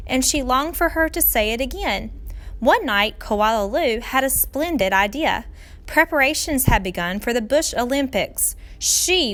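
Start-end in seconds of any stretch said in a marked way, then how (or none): none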